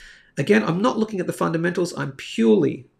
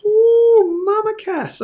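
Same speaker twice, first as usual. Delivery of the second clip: much higher, louder delighted squeal; excited ooh; 6 dB louder, much slower than usual. Real, not acted. Host reacting to the chart price to viewers, high delighted squeal.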